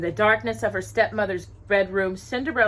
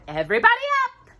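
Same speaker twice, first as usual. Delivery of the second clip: much higher, fast cheerful call; 4 dB louder, much faster than usual. A narrator's reading. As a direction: rouse the whole yard brightly. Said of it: "she called".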